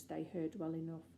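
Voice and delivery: ordinary delivery, nothing marked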